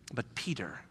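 soft breathy hush; whisper. Real, not acted. Preacher whispering Peter's name as a turning point. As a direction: hush it meaningfully.

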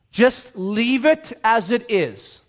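very deliberate, spaced words; measured, weighty command; slow instruction. Acted. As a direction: lay down the order slowly. Voices Jesus instructing the room.

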